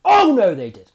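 shocked gasp of dismay; loud horrified oh no; 1 mock dismay. Viewer shocked at the TV, loud dismayed oh no. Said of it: "I'm like".

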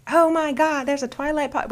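high-pitched imitation of thrilled fans; excited fan reaction. Imitating viewers hearing of a Twilight podcast, excited gasping fans. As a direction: voice leaps up in mock amazement.

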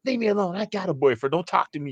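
high mocking woman's voice; S0 mimics the woman telling him off in a high voice. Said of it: "she goes".

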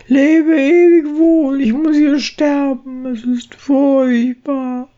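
tiny mouse lamenting his doom; squeaky mouse voice. Acted. Bräutigam nimmt Abschied. (hohes, klagendes Mäusestimmchen)

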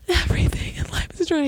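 breathy whisper of fake despair; whispered mock despair. Repeating her fake sob line to the friend in a whisper. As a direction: sigh it out as a whisper.

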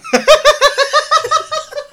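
high, loud cackle of laughter; real hearty laughter; shrieking laugh. Real, not acted. Laughing at sneeze joke.

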